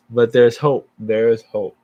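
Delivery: loud, ringing declaration of hope; emphatic hope. Real, not acted. Speaker tells his audience there is hope, loudly emphatic. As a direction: lift the room with a burst of hope. Speaker turns to a hopeful message.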